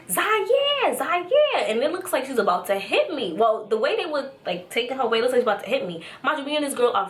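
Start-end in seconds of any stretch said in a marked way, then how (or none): 0.1-1.6 high, drawn-out mock yell; imitated yelling. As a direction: call the name high, imitating her yelling. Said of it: "she's yelling".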